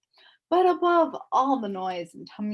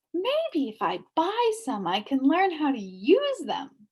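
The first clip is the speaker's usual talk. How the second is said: 1 eager; 6 semitones higher, 3 dB louder than usual. A narrator's reading. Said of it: "said Milo eagerly".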